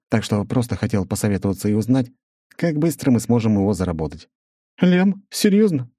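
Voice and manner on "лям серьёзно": surprised. Acted. Surprised Svetlana.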